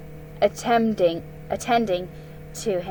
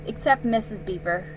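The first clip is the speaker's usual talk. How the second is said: plain reading voice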